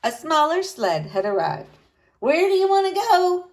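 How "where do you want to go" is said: loud, higher holler across the snow; hollering driver. A narrator's reading. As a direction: shout the question over to them. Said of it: "the driver hollered".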